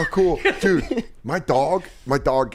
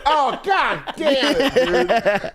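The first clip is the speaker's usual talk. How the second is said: exasperated; 10 semitones higher, much slower than usual. Retold or imitated. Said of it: "We're like".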